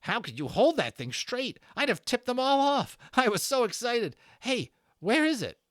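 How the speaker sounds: put-on high, loud, thrilled kid voice; a boy bursting with excitement, high and loud; excited boy. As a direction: gush it like an overexcited boy. Excited boy's voice for a figure.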